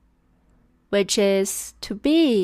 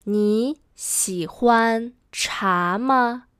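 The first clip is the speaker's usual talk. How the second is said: slow, word by word; spaced-out teaching pace; slow model sentence; slower than usual. Shown as a demonstration. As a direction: model it slowly for learners. Teacher models sentence slowly first.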